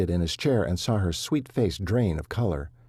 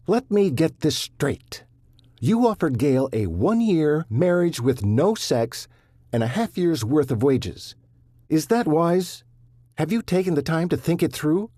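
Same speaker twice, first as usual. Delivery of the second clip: angry; 8 semitones higher, 4 dB louder than usual. Acted. Son confronting father.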